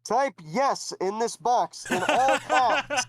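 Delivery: the head god asks the hero to confirm; booming head god. Acted. Head god questioning Dino, grand and formal. God gives absurd instruction.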